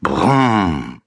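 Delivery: falling engine rumble brumm; boy playing toy car noise. A narrator's reading. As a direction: drone the Brumm like a motor. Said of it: "macht er dabei".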